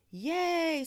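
high, loud, drawn-out whoop; cheering. A narrator's reading. Girl free of hiccups, loud high cheer. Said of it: "Sophia cheered".

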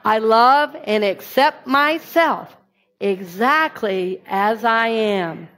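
slow, deliberate affirmation; unhurried shared mantra. Shown as a demonstration. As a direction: lead the room through it word by word. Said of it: "Will you say that with me?".

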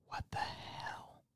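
slow, barely audible whisper; mouthed whisper. Retold or imitated. Mouthing to a sister a couple rows back, slow whisper. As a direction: mouth it silently across the room, almost no voice.

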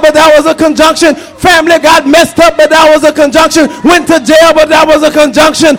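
preaching. Acted. Preacher rousing the church, full-force preaching. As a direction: preach it hard to the congregation.

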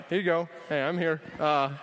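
normal talking voice

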